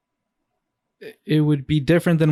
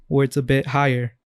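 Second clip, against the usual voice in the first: ordinary delivery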